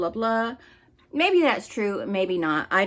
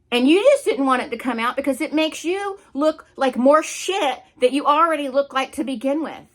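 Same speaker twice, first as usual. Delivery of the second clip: pissed off; 5 semitones higher than usual. Real, not acted. Berating Katie for hiding her son's trauma, angry. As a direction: let the anger spill over, pushing hard. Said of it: "I'm getting pissed off".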